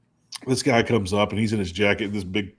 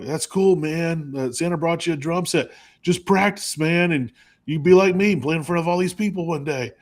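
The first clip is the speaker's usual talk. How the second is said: put-on higher, friendly pep talk to a kid; encouraging rocker; 6 semitones higher than usual. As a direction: cheer the kid on, pitched up. Band member voiced in story.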